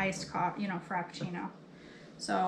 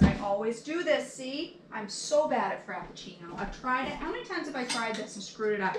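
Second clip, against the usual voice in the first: ordinary talk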